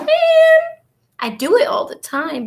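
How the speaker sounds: high, loud exasperated cry; exasperated exclamation. Retold or imitated. Woman retelling being told she loves cleaning, exasperated.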